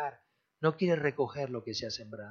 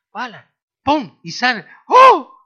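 louder, higher bursts of a miracle happening; excited healing shout; 9 semitones higher, 19 dB louder than usual. Acted. Preacher acts out young believers healing the sick, excited shout. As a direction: shout the sudden healing like a sound effect. Acted healing with laying on hands.

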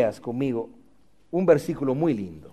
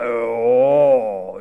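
low, drawn-out ooh; hammed-up admiring awe; impressed "oh"; 7 semitones lower than usual. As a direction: sound deeply impressed, mockingly. Acted admiration of a teacher.

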